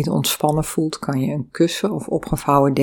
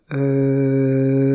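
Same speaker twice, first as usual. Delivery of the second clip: long, steady eu on one note; sustained eu sound. Shown as a demonstration. Yoga teacher demonstrates the exhale sound, long sustained eu. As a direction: hold the vowel through the whole out-breath. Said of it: "uitadem rol je terug".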